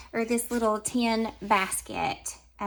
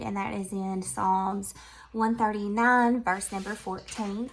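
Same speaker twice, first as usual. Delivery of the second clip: usual speaking voice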